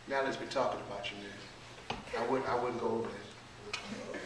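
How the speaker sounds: low, hushed aside, quick at first; gossipy confiding. Acted. Speaker stirring gossip between two audience members, hushed and confiding. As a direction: pass it on softly, behind someone's back.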